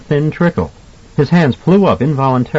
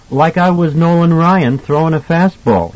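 higher, lightly voiced boy; prompt boyish reply; 7 semitones higher than usual. A narrator's reading. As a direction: answer readily as a child. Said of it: "he replied promptly".

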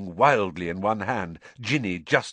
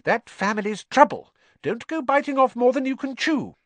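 Mrs Weasley warning; 4 dB louder than usual. Acted.

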